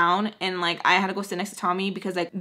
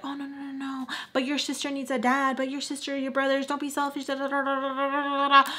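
higher, softer mimicked pleading; placating grandma; 4 semitones higher, 5 dB quieter than usual. Grandma excusing him for sister's sake, mock placating. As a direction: run on softly, mocking a peacemaker. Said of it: "my grandma's reactions of trying to always".

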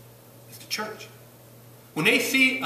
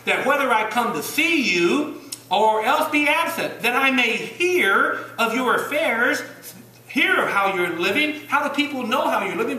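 full-force preaching; 6 semitones higher, 4 dB louder than usual. Acted. Preacher pressing Philippians on his church, loud and rising. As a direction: drive the scripture home to the congregation.

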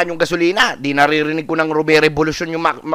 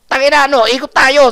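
high, loud excited urging; eager urging; 7 semitones higher, 5 dB louder than usual. Retold or imitated. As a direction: push him to go for a drive. Eager kid urging drive.

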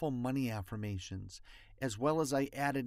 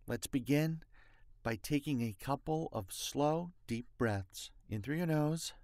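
guided breathing meditation; 6 dB quieter than usual. Acted. Leads breathing exercise.

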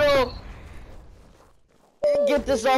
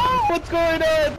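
alarmed cry; 7 semitones higher than usual. Real, not acted. Player falls, cries out.

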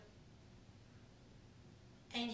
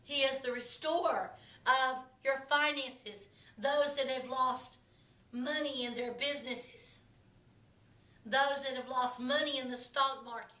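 much softer, hushed intercessory prayer; 3 semitones lower, 8 dB quieter than usual. Acted. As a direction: pray over them gently and softly. Pastor turns to quiet prayer.

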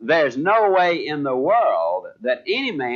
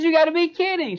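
high-pitched mock incredulity; acting out his own astonishment; retold disbelief; 12 semitones higher, faster than usual. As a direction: protest in a much higher voice.

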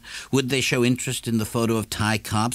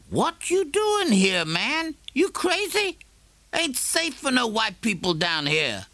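much higher, slow, streetwise neighbour voice; a neighbour challenging a man in a dark shop; Morrison's voice. A narrator's reading.

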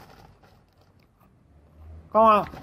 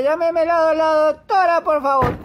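higher, louder insistent plea; raised demanding call for the doctor; 7 semitones higher, 4 dB louder than usual. Acted. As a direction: push hard to get the doctor on the line.

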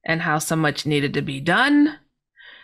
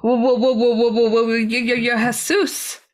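stuttering in shock; 4 semitones higher than usual. Retold or imitated. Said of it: "And I was stuttering".

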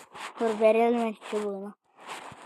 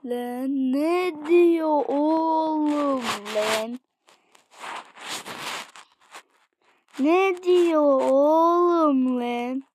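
baffled drawl; 3 semitones higher, much slower than usual. Real, not acted. Baffled by the game.

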